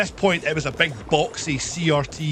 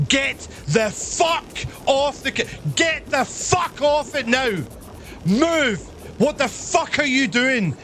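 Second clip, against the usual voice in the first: higher, angry shouted orders, slowed at the start; retold angry yell; 9 semitones higher, slower than usual. Teller yelling at girlfriend to get off his computer, angry. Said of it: "I'm like".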